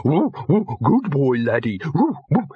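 high-pitched happy dog woofing; delighted dog bark. A narrator's reading. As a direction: woof words like an overjoyed dog. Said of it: "There was a delighted bark".

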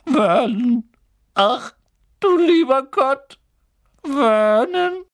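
high, loud strangled cry; horrified choking. Acted. Wife reading the letter's first line, horrified high cry. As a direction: call out in shock, nearly fainting. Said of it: "gab ein würgendes Geräusch von sich".

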